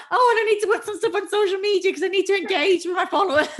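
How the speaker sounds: high, loud, rushed mock panic; flustered overwhelm. Acts out being overwhelmed by tasks.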